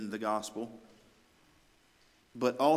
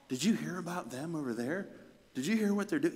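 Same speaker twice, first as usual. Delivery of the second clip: gossiping townsfolk; 5 semitones higher, faster than usual. Acted. People asking each other about the church, gossipy. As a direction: pass it on like fresh rumour. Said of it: "people start to talk".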